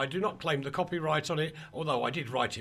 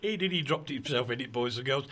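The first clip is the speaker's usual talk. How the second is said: ordinary talk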